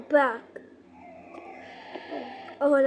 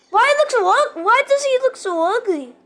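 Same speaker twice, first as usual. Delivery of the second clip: scared shriek; 10 semitones higher, 10 dB louder than usual. Real, not acted. Scared kid in horror game.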